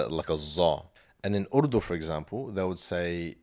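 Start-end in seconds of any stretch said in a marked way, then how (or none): 0.4-0.9 'zo' with a buzzing Z; Malay-style zo. Shown as a demonstration. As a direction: say 'zo' with a Z sound. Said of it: "like, with a Z".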